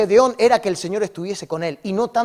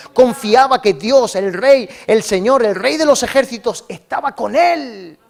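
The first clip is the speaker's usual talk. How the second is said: preaching climax; 3 semitones higher, 5 dB louder than usual. Real, not acted. Preacher tells the brothers whom Gedeón trusted, emphatic preaching climax. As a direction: proclaim each title of God with full force, stretching the words.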